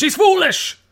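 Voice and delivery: high, loud mimicked brogue; mock Scottish outburst. Co-host heckling about the wife, high mock Scottish accent. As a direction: blurt it out as a mock Scottish cry. Mimics the quoted film line.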